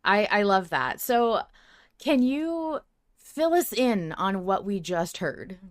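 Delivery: ordinary delivery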